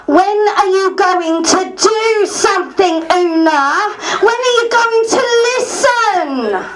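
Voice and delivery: high, loud accusing shout; angry heckler's shout; Tracy shouting. Retold or imitated. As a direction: yell it at her across the room. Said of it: "starts to shout".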